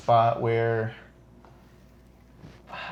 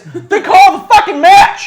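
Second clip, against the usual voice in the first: high, loud outraged yell; indignant shouting; 15 dB louder, slower than usual. Real, not acted. Host rants that the ref should end the match, indignant shout. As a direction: shout it slow and hard at the ref.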